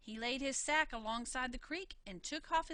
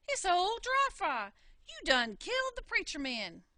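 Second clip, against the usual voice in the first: shocked discovery; 6 semitones higher, 7 dB louder than usual. Acted. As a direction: realize who it is. Brother discovers body.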